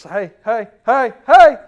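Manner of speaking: rising call ending in a high, loud shout; high loud recall call; building dog call. Shown as a demonstration. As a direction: start light and build to a shout. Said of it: "it's just like my voice".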